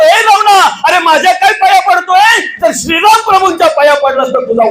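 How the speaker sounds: high shouted rebuke; furious Angad. Acted. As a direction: shout him off your feet. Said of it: "Angad बोलतो".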